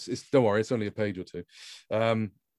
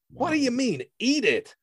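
much higher snarling tough guy; snarling demand; 14 semitones higher than usual. A narrator's reading. The dark-suited man snarling at Grand, much higher. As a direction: snarl it at him. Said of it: "demanded the dark-suited man in a kind of snarl".

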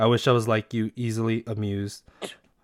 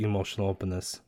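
usual speaking voice

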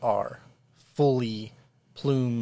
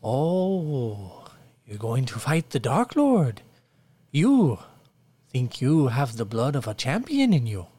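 peasant villager; 2 semitones higher, 4 dB louder than usual. Acted. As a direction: welcome the lords like a fawning villager. GM voices peasant Abel.

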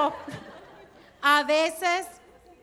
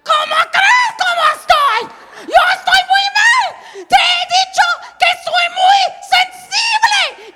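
much higher, loud furious scream; furious shout; 14 semitones higher, 10 dB louder than usual. Retold or imitated. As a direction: explode at him in rage. Grita al esposo tierno. (esposa gritándole al esposo, furia total)

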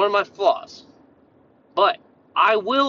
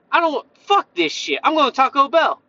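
mock outburst, higher and louder; fed up, giving up; 6 semitones higher, 4 dB louder than usual. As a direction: throw in the towel with an acted flare of frustration. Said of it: "you're gonna be like".

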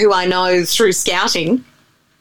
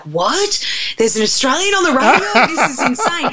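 higher-pitched retold astonishment; amazed callers; 6 semitones higher than usual. Callers hearing an Australian on the radio, amazed. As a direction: imitate listeners' excited disbelief.

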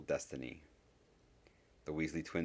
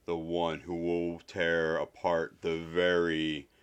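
slow prophetic intoning; hollow voice cutting through the room; huge hollow voice; 4 dB louder, much slower than usual. A narrator's reading. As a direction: draw each word out like a doom-laden oracle. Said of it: "a huge hollow voice that cut through".

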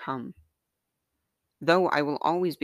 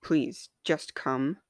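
plain reading voice

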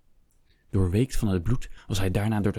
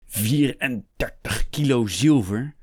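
slow, higher incredulous question; aghast, every word drawn out; outraged disbelief; 4 semitones higher, 4 dB louder, slower than usual. Acted. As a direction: stretch out the figure in disbelief. Hector outraged at the sum.

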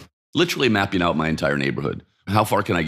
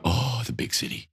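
fond sigh; 7 semitones higher, 5 dB quieter than usual. Retold or imitated. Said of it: "we were both going".

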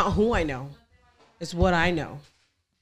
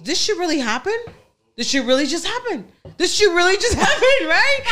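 higher, disbelieving, breaking into laughter by the end; stunned disbelief; 9 semitones higher than usual. Retold or imitated. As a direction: replay the shock until it cracks into a laugh. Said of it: "you go into this mode that you're like".

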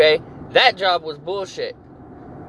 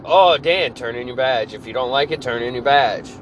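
mocking bosses; 7 semitones lower than usual. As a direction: parrot the bosses with scorn. Mocking the bosses' stock reply.

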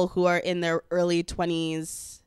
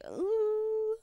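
coy delight; 8 semitones higher than usual. Retold or imitated. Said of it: "And I'm like".

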